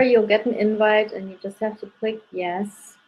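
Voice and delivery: ordinary talk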